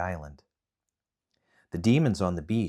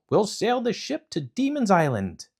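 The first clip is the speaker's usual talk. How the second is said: 1 eager hero; 13 semitones higher, 4 dB louder than usual. Acted. Momotaro rallying crew.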